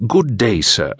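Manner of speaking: put-on the stranger greets in a lightly done posh English accent; a hint of Shakespearean English gentleman; heavy English accent. A narrator's reading. English stranger greets the postal worker, in a light English accent. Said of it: "the stranger spoke with a heavy English accent".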